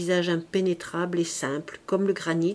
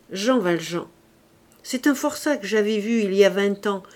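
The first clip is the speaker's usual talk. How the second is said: ordinary delivery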